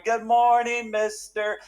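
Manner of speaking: high, chanted mimicry of small children; high-pitched little kids. Hundred little voices greet the teacher, imitated high chorus. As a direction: imitate a roomful of kids reciting in unison. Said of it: "all those hundred little voices that were going".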